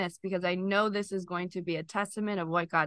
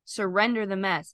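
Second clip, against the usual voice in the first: ordinary talk, nothing marked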